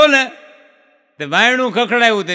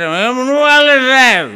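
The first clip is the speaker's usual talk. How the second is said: put-on drunk protest; 4 semitones higher, 5 dB louder than usual. Drunk argues with bank manager.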